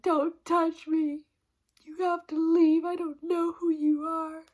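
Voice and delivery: mocking, frantic keep-away plea; mock frightened yelling. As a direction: imitate him pushing away a stranger. Husband scared of stranger, retold.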